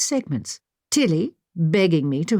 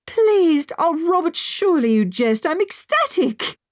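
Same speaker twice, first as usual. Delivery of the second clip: delighted; 12 semitones higher than usual. A narrator's reading. Overjoyed at seeing Samuel.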